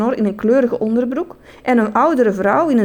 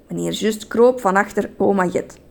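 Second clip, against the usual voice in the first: plain reading voice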